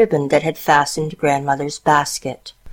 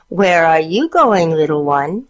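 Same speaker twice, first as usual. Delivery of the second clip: usual speaking voice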